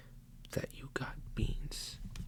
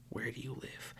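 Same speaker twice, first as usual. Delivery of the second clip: ordinary delivery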